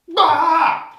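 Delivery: very loud, high "Bah!"; sudden scare shout. Acted. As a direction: pounce with a startling shout. Shiva scares Parvati again.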